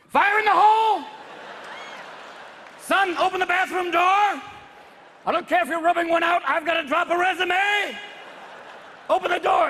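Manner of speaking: loud frantic yell. Pretend. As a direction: bang on the door and yell. Father yelling at bathroom door.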